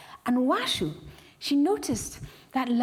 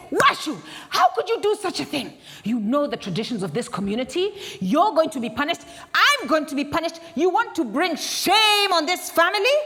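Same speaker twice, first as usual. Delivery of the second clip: higher, louder outraged husband; furious scolding; 4 semitones higher, 3 dB louder than usual. Acted. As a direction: scold her for shaming the family. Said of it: "he was furious".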